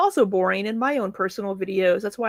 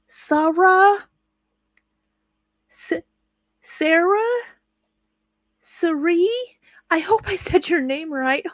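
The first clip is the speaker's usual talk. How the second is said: high unsure mimicking voice; imitating hesitant name check; 8 semitones higher, slower than usual. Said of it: "She would spend... being like".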